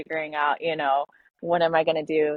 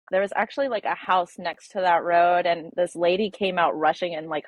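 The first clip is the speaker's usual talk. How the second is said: ordinary talk, nothing marked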